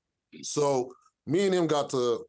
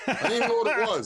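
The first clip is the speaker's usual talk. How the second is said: laughing; 9 semitones higher, faster than usual. Real, not acted. Recalling the Carolina Reaper dare.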